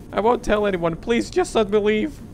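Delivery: put-on high, pleading, scared girl; high-pitched frightened begging. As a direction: plead to be let go.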